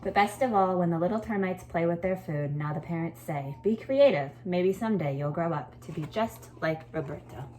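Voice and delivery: lively read-aloud, lifting the key words; storytelling voice. A narrator's reading. Teacher reading a story to her class, lively storytelling voice. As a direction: read it like a picture book to a class.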